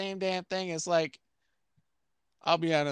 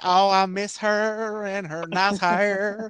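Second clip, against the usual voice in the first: put-on slow, drawn-out, higher country-singer accent; mock country accent; 5 semitones higher, 3 dB louder, much slower than usual. Host imitates lovesick country singers, mock country accent. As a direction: stretch the words in a hammed-up country accent. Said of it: "a horrible accent".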